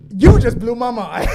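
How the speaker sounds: loud, astonished outburst; amazed shout. Real, not acted. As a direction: blown away by the idea. Reacting to a shocking super chat.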